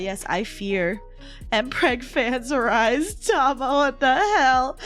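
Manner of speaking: usual speaking voice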